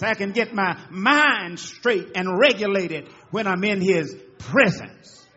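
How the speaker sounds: loud, swelling sermon build; full-force preaching with a laugh at the start; fervent preaching. Acted. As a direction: lift the room with each line.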